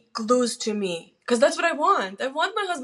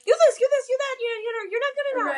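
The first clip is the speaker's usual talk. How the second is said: mocking husband's insults; 14 semitones higher, much faster than usual. Wife imitates her mean husband mid-argument, sneering high mockery. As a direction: mimic him jabbing insult after insult, pitched way up. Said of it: "He's like".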